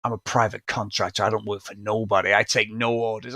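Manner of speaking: low, quiet tough-guy contractor; Hogan. Retold or imitated. Imitating the film's private contractor to his co-host, low tough guy. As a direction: drop the voice and lay down terms. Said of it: "this is Hogan flexing his fucking artistic license".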